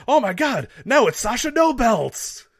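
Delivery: high, loud mock announcer yell; hammed-up ringside hysterics, high and loud; imitated commentator scream. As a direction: scream it like an overexcited commentator, drawn out. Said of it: "Corey Graves screaming".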